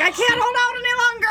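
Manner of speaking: high, strained pleading voice; voicing her butt. Her exhausted butt begging for mercy, high strained plea. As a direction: plead like you're about to burst. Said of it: "it was like".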